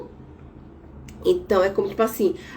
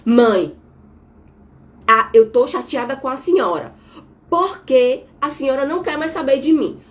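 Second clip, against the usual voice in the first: louder, sharp reproach to mother; aggressive complaint; 3 semitones higher, 5 dB louder than usual. Shown as a demonstration. Therapist demos an aggressive talk to her mother, loud and accusing. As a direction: snap at her with blame in the voice. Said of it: "Olha o tom de voz".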